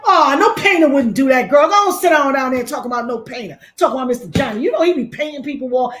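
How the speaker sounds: high, loud, disbelieving mock defender; outraged fan. Scoffing at the audience, voicing a fan defending a painter, high outraged squeal. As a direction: squeal it like an indignant fan who can't believe it.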